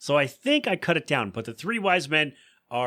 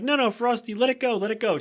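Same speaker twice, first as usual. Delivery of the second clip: put-on high, pleading kid voices; lightly voiced pleading kids; mock pleading kids; 9 semitones higher than usual. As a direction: beg him to drop it.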